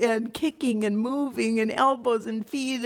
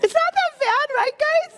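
high, louder sarcastic chirp; mock breezy; 5 dB louder than usual. Host joking to guys about birth pain, sarcastic high chirp. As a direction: brush off the pain with fake cheer.

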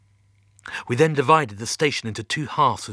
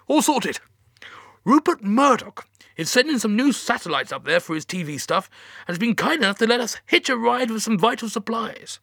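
higher-pitched, lively boss on the line; mission control boss; 9 semitones higher, 4 dB louder than usual. Acted. Mission Control to the stranded captain about satellites, breezy and high. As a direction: talk the captain into a favour, higher and brisk.

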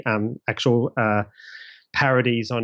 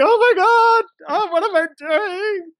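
much higher, slow and drawn-out cry of dismay; hammed-up cringe at hearing his own old recording; mock horrified; 4 dB louder, much slower than usual. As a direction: wail it in comic despair at himself. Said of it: "I was just listening there going".

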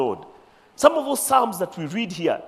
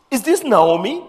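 surprised question; 6 semitones higher, 7 dB louder than usual. Acted. Women of Bethlehem questioning Naomi.

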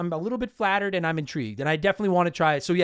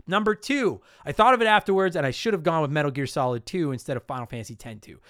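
ordinary talk

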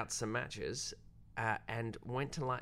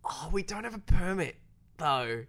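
high, louder, sheepish protest; sheepish excuse; 8 semitones higher, 7 dB louder than usual. Retold or imitated. Director frets about permits to actor, high and sheepish. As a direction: plead the permit problem, half embarrassed. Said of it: "And I said".

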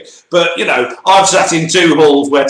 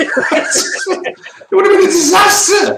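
high, slow laughing exclamation; laughing outburst; slower than usual. Real, not acted. Co-host reacting to a comedian as pit reporter, laughing outburst. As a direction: cry it out through helpless laughter. Cracking up at a comic idea.

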